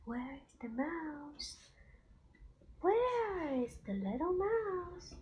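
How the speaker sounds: slow rising singsong read; reading to a toddler. Acted. Mother reading to child.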